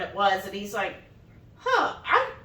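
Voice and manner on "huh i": much higher put-on hesitant voice; mock puzzled husband. Wife mimicking husband caught off guard, much higher. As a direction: stall like a confused guy.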